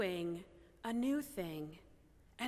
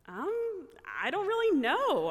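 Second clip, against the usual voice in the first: high-pitched, louder, hesitant retelling; flustered reply; 8 semitones higher, 5 dB louder than usual. Retold or imitated. Preacher asked for phone exorcisms, flustered high-pitched answer. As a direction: stall on a high um, then push out the answer. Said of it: "I had no idea how to respond".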